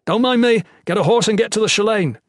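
high, loud urgent call; urgent shout. A narrator's reading. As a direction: shout the order fast. Wounded lord urges knights on.

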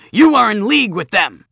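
high, loud tantrum shout; angry accusation. Acted. Bully accusing the girl, high angry shout. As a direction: yell it like a stamping tantrum. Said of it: "stomped like an angry donkey".

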